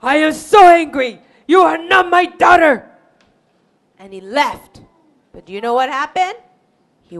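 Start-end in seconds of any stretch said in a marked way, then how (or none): 0.0-2.9 loud, furious father; angry yell. Acted. Father disowns his runaway daughter, angry yell. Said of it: "He yelled and said".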